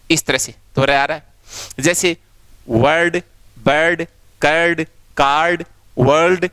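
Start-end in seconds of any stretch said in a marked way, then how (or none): 2.7-6.5 वर्ड, बर्ड, कर्ड drawn out slowly, each on its own; slow example words. Shown as a demonstration. Teacher slowly models r words for the class. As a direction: spell the r sound out word by word. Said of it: "सब में रे आ रहा है".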